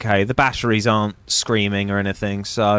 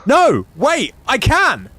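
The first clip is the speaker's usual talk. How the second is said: excited shout; 12 semitones higher, 5 dB louder, much slower than usual. Real, not acted. Sudden realization he can do it.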